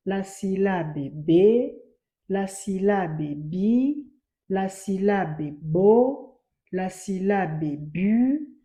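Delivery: slow, measured "la syllabe", each final syllable drawn out; chant-like reading of "la syllabe bu", mostly rising at the end; read-along drill. Shown as a demonstration. Teacher reads "la syllabe" list together with pupils, slow drill. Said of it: "On peut lire ensemble".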